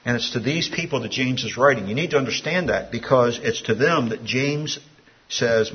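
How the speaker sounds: ordinary talk, nothing marked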